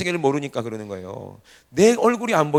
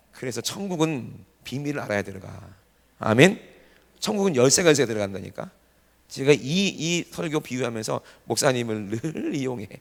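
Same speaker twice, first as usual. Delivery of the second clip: normal talking voice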